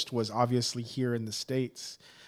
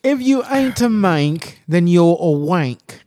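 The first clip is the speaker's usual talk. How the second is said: mock British accent; 5 semitones higher, 12 dB louder, slower than usual.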